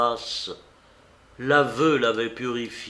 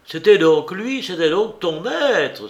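higher, louder cry of realization; sudden realization; 7 semitones higher, 7 dB louder than usual. A narrator's reading. Marin suddenly understands, crying out to Monique. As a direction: it dawns on him all at once. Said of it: "s'écria Marin, dont l'esprit s'illumina soudain".

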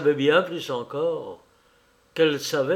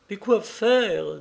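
slow, higher, pleading; despairing plea; 7 semitones higher, slower than usual. Acted. Lady begging the clergyman for advice, slow despairing plea. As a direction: ask it helplessly.

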